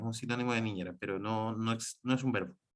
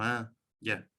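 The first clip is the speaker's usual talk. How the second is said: dismissive ah; 3 semitones lower, 3 dB quieter than usual. Shown as a demonstration. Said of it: "Es como".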